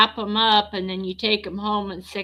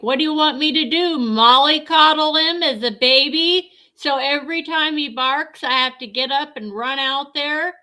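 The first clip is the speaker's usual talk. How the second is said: exasperated rant; 7 semitones higher, 5 dB louder than usual. Retold or imitated. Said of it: "And so I'm like".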